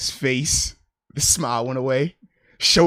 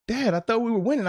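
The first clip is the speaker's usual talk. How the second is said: put-on higher kid voice; a child's confused question, pitched up; puzzled little son; 7 semitones higher than usual. Son asking dad about the game, puzzled.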